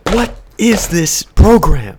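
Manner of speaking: loud, slow, barking drill sergeant. Pretend. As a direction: bark it at recruits. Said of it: "a drill sergeant thing".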